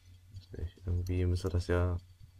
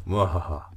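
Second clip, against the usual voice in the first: evil chuckle; 5 dB louder, slower than usual. Pretend. Mock villain laugh at the saw.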